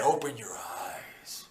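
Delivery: breathy ghostly whisper, slow; eerie whisper. Retold or imitated. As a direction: whisper it like an unseen presence. Voice in sleep paralysis.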